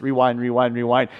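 low, soft rapid mutter to himself; working the remote, absorbed; muttered rewind. Retold or imitated. Fan rewinding the catch for his family, low mutter.

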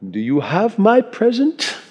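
put-on high, rising English-accented question; old English nobleman asking expectantly. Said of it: "He didn't say it with an English accent".

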